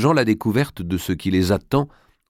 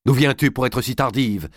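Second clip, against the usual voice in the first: stern mother, pitched higher; cold questioning; 5 semitones higher than usual. A narrator's reading. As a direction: question your late daughter coldly. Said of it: "demanda Dame Eliabel froidement".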